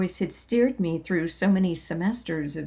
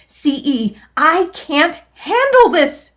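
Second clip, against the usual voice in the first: much higher, much louder, slow furious cry; angry yell; 8 semitones higher, 9 dB louder than usual. Acted. Angry at C E, a much higher, louder, slow yell. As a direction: yell it out in rage and pain. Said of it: "I yelled".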